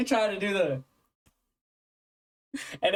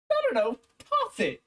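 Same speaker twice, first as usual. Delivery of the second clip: mock exasperation, much higher and louder; exasperated self; 4 dB louder than usual. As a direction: throw it out in high disbelief. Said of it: "I was like".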